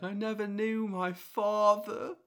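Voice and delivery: put-on high, drawn-out Australian accent; Finding Nemo shark. Co-host imitating a film shark, high Australian accent. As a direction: put on a slow, high-pitched Aussie shark. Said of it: "shark voice as the Finding Nemo sharks".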